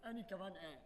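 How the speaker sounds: court each other gently, keeping it small; hushed tender flirting; shy sweet talk. Acted. Shy lovers exchange sweet words.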